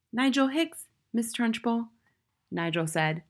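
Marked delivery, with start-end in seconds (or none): 0.1-1.9 put-on high little-boy voice, rising; high, tentative schoolboy; small brave boy. A narrator's reading.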